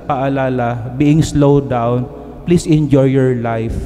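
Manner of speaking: ordinary talk, nothing marked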